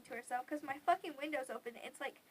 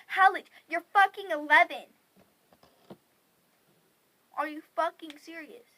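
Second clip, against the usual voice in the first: very loud, higher-pitched retold outburst; outraged; 3 semitones higher, 15 dB louder than usual. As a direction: explode at your sister in disbelief.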